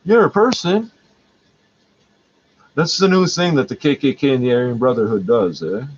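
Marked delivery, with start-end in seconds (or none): 0.0-0.9 high, louder patronizing sing-song; mock condescension. Girl in line talks down to vlogger, high patronizing. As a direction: parody the girl's fake kindness. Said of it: "Girl turns to me".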